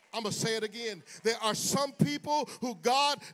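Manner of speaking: loud, rhythmic preacher's cadence; full-force preaching with organ. Acted. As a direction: preach hard to the congregation.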